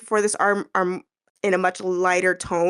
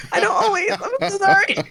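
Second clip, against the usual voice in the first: high, fake-crying apology; hammed-up teary sorry; whiny mock apology; 10 semitones higher than usual. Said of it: "I'm like".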